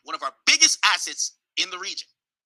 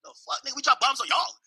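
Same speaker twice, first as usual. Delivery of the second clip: higher mock disbelief, a bit softer; baffled American; 7 semitones higher, 3 dB quieter than usual. US reacting to the celebrating crowd, mock baffled. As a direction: play the confused US side. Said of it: "We're like".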